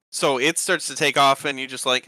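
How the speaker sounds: ordinary talk, nothing marked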